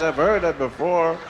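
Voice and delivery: normal talking voice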